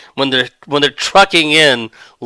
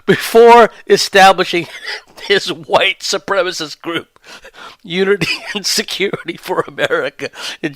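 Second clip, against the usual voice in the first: high, giggly voice breaking up; reading the news through laughter; laughing while reading; 6 semitones higher than usual. Real, not acted. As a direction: try to read through the giggles. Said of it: "I don't know why I'm laughing".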